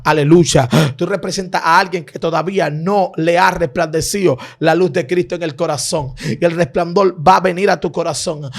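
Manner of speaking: fervent preaching. Acted. Preacher warning the congregation not to be persecutors, fervent preaching. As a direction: press the exhortation hard, pitch lifted.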